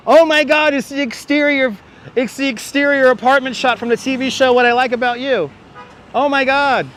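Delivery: high, thrilled recognition; excited. Real, not acted. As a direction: gush over a happy discovery. Recognizes a TV show building.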